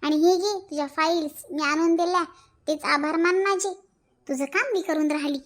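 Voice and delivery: ordinary talk, nothing marked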